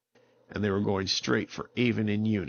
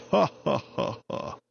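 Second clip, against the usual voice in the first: laughing tree; 5 semitones higher than usual. Acted.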